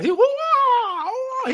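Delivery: high-pitched laughing "woo, wah" fight noises; mock kung fu yells. Recapping the fight to a friend, laughing mock kung fu cries. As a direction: squeal out the kung fu sounds through laughter. Mimicking fight sounds while kicking.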